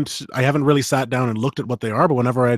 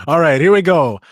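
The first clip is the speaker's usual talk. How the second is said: louder, pumped-up kickoff; excited; 4 semitones higher, 9 dB louder than usual. Real, not acted. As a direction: launch in with energy. Starting the reading.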